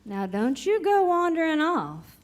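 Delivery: usual speaking voice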